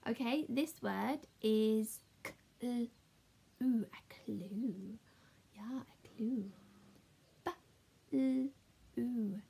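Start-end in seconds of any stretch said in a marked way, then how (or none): 2.2-3.8 "k-, ooh" as separate slow phonics sounds; segmented letter sounds, held apart; sounding out. Shown as a demonstration. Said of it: "let's do some really speedy reading". 7.4-9.4 "B-" and "ooh" as separate sounds; short "B-", pause, then held "ooh"; sounding out. Shown as a demonstration. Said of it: "if you can read these words".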